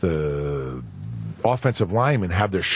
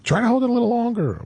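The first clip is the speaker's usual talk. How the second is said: Matt Stover's voice; 12 semitones higher than usual. Retold or imitated. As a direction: squeak it like the pestering kicker. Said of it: "Matt Stover once again".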